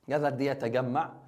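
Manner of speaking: ordinary talk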